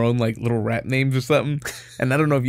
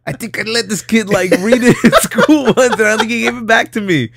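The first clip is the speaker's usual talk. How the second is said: high-pitched nervous mock excuse, breaking into laughter; sheepish kid excuse; 8 semitones higher, 4 dB louder than usual. Kid explaining the lost library book to parents, mock sheepish. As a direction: imitate a kid confessing, pitched well up, half laughing.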